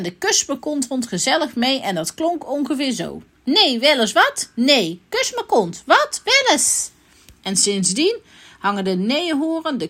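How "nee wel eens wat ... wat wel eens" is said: quick-switching character retorts; punchy squabble leaping up in pitch; acted bickering. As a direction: voice four grumpy characters in turn. Demonstrates how the four chat.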